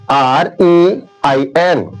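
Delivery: R E I N spelt very slowly, letter by letter; spelling out. Shown as a demonstration. Teacher spells the word slowly for the class. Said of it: "example देखिए".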